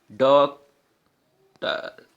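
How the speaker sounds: Doctor very slow, long gap before टर; syllable by syllable. Shown as a demonstration. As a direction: make doctor two clearly separate sounds. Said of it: "टू बार effort करना पड़ रहा है". (Doctor में लंबा विराम देकर)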